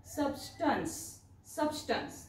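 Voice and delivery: soft teacher's model of सबस्टन्स; pronunciation model. Shown as a demonstration. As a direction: model सबस्टन्स quietly, the correct way. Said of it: "असा उच्चार होतो".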